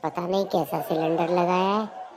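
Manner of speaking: a lightly put-on complaining wife; mock wife's complaint; nagging wife voice.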